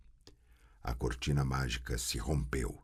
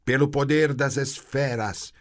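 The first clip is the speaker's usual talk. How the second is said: higher, louder straining cry; groaning incantation; strained invocation; 9 semitones higher, 7 dB louder than usual. A narrator's reading. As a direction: force out the spell. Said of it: "gemeu a feiticeira".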